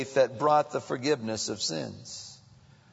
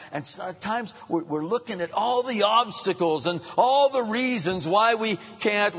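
ordinary talk, nothing marked

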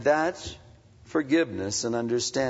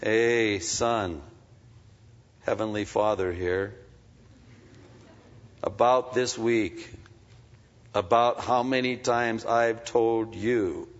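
gentle heavenly father; 3 semitones lower, slower than usual. Retold or imitated. Heavenly Father gently reminding his son, lightly voiced. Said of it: "I hear this voice saying".

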